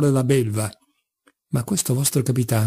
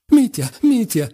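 higher and louder, a forced weepy wail; hysterical tearful cry; 7 semitones higher, 4 dB louder than usual. A narrator's reading. Father cries out to his son, hysterical and teary. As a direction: squeeze out fake tears as you call his name. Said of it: "istericamente spremendo dagli occhi qualche lacrima".

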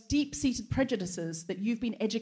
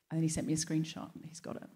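normal talking voice

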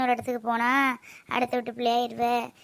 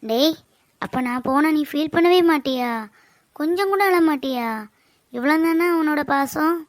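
hurt reproach; 5 semitones higher, 5 dB louder than usual. Acted. Sister hurt by brother's teasing.

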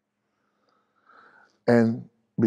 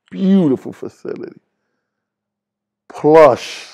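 sarcastic; 2 semitones higher, 8 dB louder than usual. Real, not acted. Ironic praise of a jail cell.

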